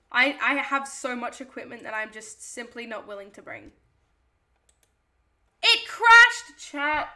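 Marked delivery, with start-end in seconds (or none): 5.6-7.1 high, loud outburst of dismay; sudden loud cry of frustration; dismayed shout. Real, not acted. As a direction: yell it out to the chat in dismay. Stream crashes, streamer cries out.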